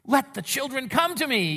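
a hint of the indignant Jesus; a touch of annoyance. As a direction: let some irritation through. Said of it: "It was tone".

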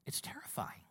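whispered, very soft; hushed dread. Acted. Preacher reliving a first solo landing, hushed dread. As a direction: drop to a whisper for the fear.

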